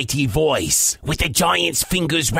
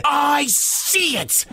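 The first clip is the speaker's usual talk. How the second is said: higher, slow pirate cry; excited pirate; 8 semitones higher, slower than usual. Acted. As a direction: draw it out like a pirate sighting treasure. Pete the pirate spots treasure.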